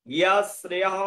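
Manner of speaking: ইয়া শ্রেয়ঃ with a sounded "-ha" at the end; model pronunciation. Shown as a demonstration. Teacher showing how it is pronounced, careful ইয়া শ্রেয়ঃ. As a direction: open ইয়া as "ya", echo the visarga in শ্রেয়ঃ. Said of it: "এরকম উচ্চারণ হবে".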